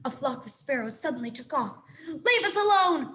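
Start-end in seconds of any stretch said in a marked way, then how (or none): 2.1-3.1 high, louder squeal; frightened squeak. A narrator's reading. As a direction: squeak in fear. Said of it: "squeaked Dart".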